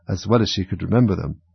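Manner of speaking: ordinary delivery, nothing marked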